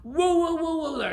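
high, loud soothing whoa; calming whoa. Acted. God calms panicking Jack, steadying. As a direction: rein him in gently. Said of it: "said God".

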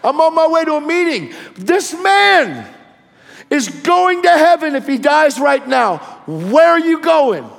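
very high, loud impassioned preaching; full-force preaching. Retold or imitated. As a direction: cry out the gospel as a fired-up preacher.

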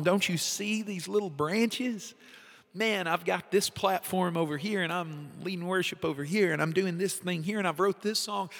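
eager, high bragging voice; showing off to God. Retold or imitated. To God, proud of his own branches, eager high bragging. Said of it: "And I'm like".

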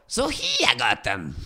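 grin through it like the Joker; Joker impression. Shown as a demonstration. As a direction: manic villain greeting. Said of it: "Manda um completo aí".

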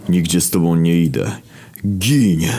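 defiant cry, rising into the final word; mild acted yell. Death charging the monster, mild defiant yell. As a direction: yell building on the last word. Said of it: "wrzasnął śmierć".

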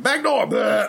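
laughing mock gag; high, laughing gag noise; mock retch. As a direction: blurt it like vomit coming up. Body mimicking vomit coming up.

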